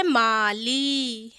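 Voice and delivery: माली slowly, "maaa-leee"; drawn-out final ee in माली; long ee. Shown as a demonstration. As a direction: stretch both vowels of माली. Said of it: "ला में भी बड़ी की मात्रा है".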